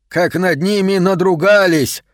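high-pitched, grave indignation; grimly serious. A narrator's reading. Dealer explains the books' ruin to Max, grimly serious. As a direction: pitch it up with grim outrage. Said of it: "с мрачной серьёзностью пояснил Аткинсон".

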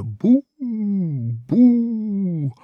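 high, booming imitation of a shotgun blast; imitated gun boom. A narrator's reading. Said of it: "раздалось оглушительное".

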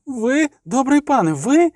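put-on high, incredulous young monk; timid, hopeful disbelief in a high voice; amazed. A narrator's reading. Novice amazed at the supposed exorcist.

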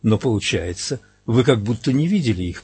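usual speaking voice

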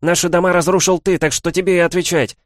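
high, loud, yelled accusation. A narrator's reading. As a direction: still shouting, confidence fading. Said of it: "уже менее уверенный".

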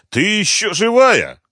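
slow, high, loud astonishment; disbelieving. A narrator's reading. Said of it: "не поверил своим глазам Малявка".

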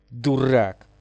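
mildly angry outburst. A narrator's reading. Лиза rebukes the ghost, mild anger. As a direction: spit out the insult with a touch of anger. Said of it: "разозлилась Лиза".

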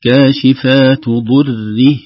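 "ضره" very slow and loud, with a full kasra before the ha; careful recitation. Shown as a demonstration. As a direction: draw out "كاشفات ضره". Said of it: "كذلك مثلها". (تحقيق الكسرة في "ضره")

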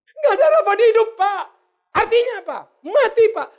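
very loud, high mock wail; hammed-up wailing. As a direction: imitate a hysterical patient crying out to the pastor. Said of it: "Ngapain kau nangis?". (meraung berlebihan, nada tinggi dan keras)

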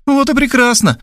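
high, pleased exclamation; delighted Tamara. A narrator's reading. Tamara on the phone delighted with the news. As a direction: gladly approve. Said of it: "обрадовалась Тамара".

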